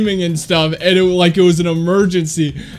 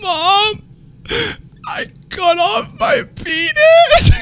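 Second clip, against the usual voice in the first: very high, slow wailing fake sob; fake crying voice; much slower than usual. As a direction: wail it as a fake panicked cry, very high. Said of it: "This is exactly what I said".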